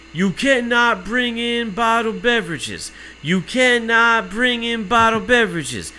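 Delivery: high, slow, drawn-out repeated announcement; mimicked guard chanting the rule; droning announcer agent. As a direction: call it out like a rote security warning. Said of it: "no pause".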